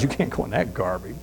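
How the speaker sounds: low and hushed, gently firm; the host quietly turning away the overdressed guest; quiet correction. Acted. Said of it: "And the guy goes".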